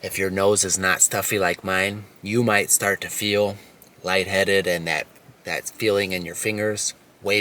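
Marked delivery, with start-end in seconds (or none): none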